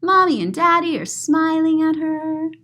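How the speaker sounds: ordinary delivery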